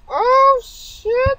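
high shriek of fright; startled yelp. Acted. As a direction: jump out of your skin mid-word. Character startled by a scare.

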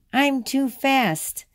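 put-on high, drawn-out child's taunt; lightly acted yell of a child running off; playful mock yell. A narrator's reading. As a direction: call it out high and slow, lightly teasing. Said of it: "she yelled".

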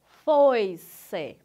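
"foi-se" in two slow beats, "foi" kept whole and loud; syllable division. Shown as a demonstration. Teacher showing diphthongs are not separated, syllable division. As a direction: split "foi-se" after the diphthong. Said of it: "Divisão silábica".

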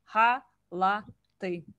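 Халаты drawn out slowly with a breathy h; slow and careful. Shown as a demonstration. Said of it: "this is a h sound".

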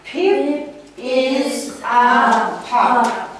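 very slow, word by word; slow reading aloud. Shown as a demonstration. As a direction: read it slowly for children. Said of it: "the sound we're going to do here".

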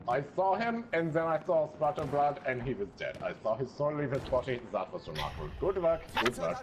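put-on pompous German officer accent. Officer awarding his soldier the Iron Cross, in a German accent. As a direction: commend them like a proud German general.

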